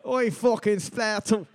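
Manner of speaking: slow, put-on Scottish accent on a joke word. Cohost asks for it, so a mock Scottish accent. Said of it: "say that with a Scottish accent".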